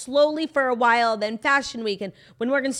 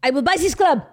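high, loud boast in a put-on French accent; hammed-up French-accented club tantrum; mock French accent; 8 semitones higher, 6 dB louder, much slower than usual. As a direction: declare it French-accented, drawing out the repeat. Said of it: "I was doing the wrong accent".

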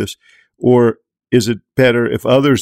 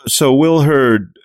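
usual speaking voice

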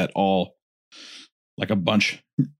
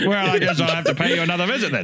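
Savile impression; 7 semitones higher, faster than usual. Playing Savile replying to the child.

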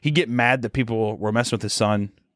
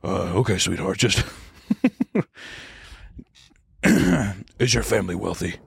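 scheming Walter; 4 semitones lower than usual. Retold or imitated. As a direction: mutter the plan low to himself.

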